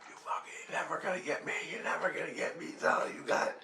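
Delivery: whispered curses through the teeth; a silent yell, all whisper; internal swearing. Retold or imitated. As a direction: swear at him under your breath, like it's only in your head. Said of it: "cursing, and yelling at him".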